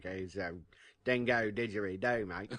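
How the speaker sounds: hammed-up Australian accent; thick Australian accent. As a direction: parody a broad Aussie accent. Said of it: "I don't have a thick Australian accent".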